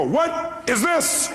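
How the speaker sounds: high, drawn-out, incredulous sarcastic shout; mocking what is this. Friend holding the salt before your face, mock incredulous shout. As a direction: stretch it out, pointing at the obvious.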